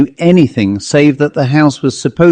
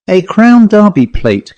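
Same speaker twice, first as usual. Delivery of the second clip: raised and louder, pressing on Crown Derby; firm correction; 7 semitones higher, 4 dB louder than usual. A narrator's reading. Miss Pym correcting her cousin about the plate, firmly. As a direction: set her straight, a touch higher and louder. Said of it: "corrected Miss Pym".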